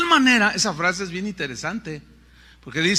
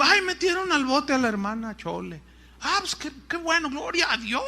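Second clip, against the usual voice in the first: hammed-up, higher, breezy delight; mock cheer; 6 semitones higher, 3 dB quieter than usual. Preacher mocks a churchgoer cheering at the jailing, hammed-up. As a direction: play it as absurdly pleased news. Mocking absurd cheerful reaction.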